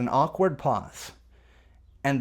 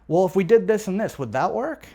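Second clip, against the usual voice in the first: higher, easy suggestion rising on "work"; reasonable man tonality; 5 semitones higher, 5 dB louder than usual. Shown as a demonstration. Salesperson offering a trial close, reasonable man tone. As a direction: float it as a perfectly reasonable question. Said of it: "He calls it the reasonable man tonality".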